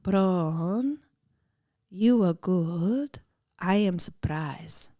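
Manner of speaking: put-on slow, deliberate Polish-accented pronouncement; mother-in-law's slow Polish-accented compliment. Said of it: "this is where I do the accent".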